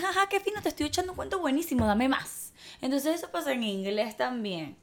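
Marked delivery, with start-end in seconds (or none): 0.0-2.5 higher, mock-excited fake laugh; mock eager reply. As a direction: beg for more like a hammed-up fan. Imitando la respuesta deseada. (entusiasmo fingido y burlón)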